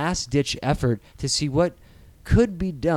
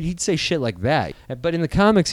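ordinary delivery

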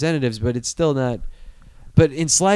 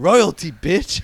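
a hint of cocky kid, slightly higher; lightly mock a swaggering little tough guy; imitated gangster nephew; 4 semitones higher, much slower than usual. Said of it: "like, gangster sometimes, my nephew".

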